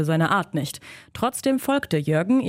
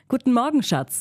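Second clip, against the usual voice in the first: cheerful; 7 semitones higher than usual. Acted. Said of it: "sagte sie fröhlich".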